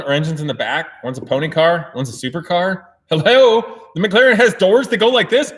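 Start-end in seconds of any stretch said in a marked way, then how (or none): 3.1-5.5 high, loud, incredulous outburst; exaggerated loud disbelief; mock disbelief. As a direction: argue it as blindingly obvious. Mock disbelief, arguing for the McLaren.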